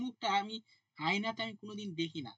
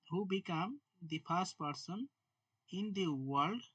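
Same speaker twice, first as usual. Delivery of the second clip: slow dictation; much slower than usual. Shown as a demonstration. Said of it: "dictating slowly to students".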